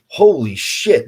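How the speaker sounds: loud, drawn-out amazement; astonished. Retold or imitated. Reliving his amazement at the video.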